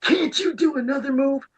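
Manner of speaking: high whiny mock of a sore loser; mocking whine. Imitating a friend whining about the repeated move. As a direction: mimic a friend begging for a new move. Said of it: "he was like".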